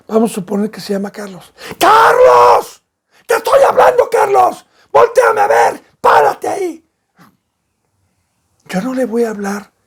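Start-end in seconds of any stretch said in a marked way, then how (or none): 1.8-6.8 desperate shout, much higher and louder; frantic father yelling. Acted. Father screaming at his son running toward the crocodile. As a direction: scream at the child to stop him dead. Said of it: "Tengo que impactarlo, tengo que pararlo".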